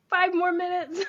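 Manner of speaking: laughing. Retold or imitated. Repeats the quote, laughing.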